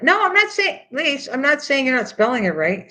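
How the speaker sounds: high, agitated protest; a real flustered protest; defensive protest. Real, not acted. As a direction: insist you didn't mean it, voice climbing. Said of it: "She's getting mad".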